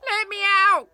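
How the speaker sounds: high, loud desperate plea; cry for help. Acted. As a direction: bang on the door and beg. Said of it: "cried the boy".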